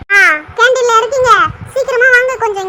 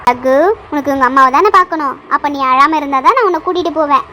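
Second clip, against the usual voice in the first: ordinary talk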